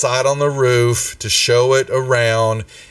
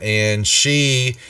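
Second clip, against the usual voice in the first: ordinary talk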